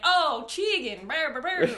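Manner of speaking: high, loud, drawn-out mock Southern drawl; teasing Southern accent. Guest mimics teasing her Southern friend, mock Southern accent. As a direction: playfully exaggerate a Southern accent into babble. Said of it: "make fun of her accent in a loving way".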